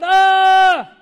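sudden loud high scream of fright; terrified cry. Retold or imitated. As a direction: shriek like you have just seen a ghost. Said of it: "they cried out".

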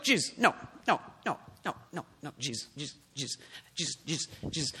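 very soft, repeated pleading objection; acting out a discreet word taken aside, voice dropped low; hushed protest. Peter takes Jesus aside to object.

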